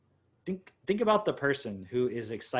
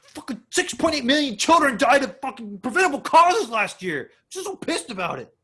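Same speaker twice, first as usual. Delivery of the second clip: loud, high impassioned rant; mock outrage; 13 semitones higher, 10 dB louder than usual. Friend ranting angrily about preventable child deaths. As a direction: rage about it like it truly matters. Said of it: "I'm like".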